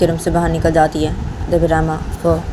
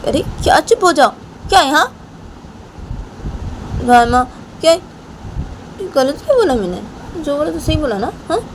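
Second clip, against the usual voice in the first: high-pitched angry wife; 10 semitones higher than usual. Retold or imitated. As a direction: throw the question back, pitched way up. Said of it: "तो राम".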